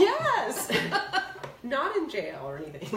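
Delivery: laughing. Real, not acted. Joke about cousin Johnny.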